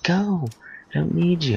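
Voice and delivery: squealed play-acted tantrum; retold kid yelling. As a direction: imitate a child sending parents away. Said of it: "I'm yelling at them now".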